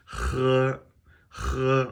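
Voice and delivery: "He" with a strong raspy h, high and level; demonstrated h sound. Shown as a demonstration. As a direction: scrape the h of "He" and hold it high. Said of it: "How are you doing with your h's?".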